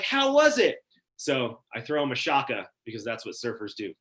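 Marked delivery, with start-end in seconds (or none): 0.0-0.8 high, loud, eager question; excited. Retold or imitated. Friends greeting the surfer back on the beach, excited. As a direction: imitate friends calling out excitedly. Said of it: "they're all excited".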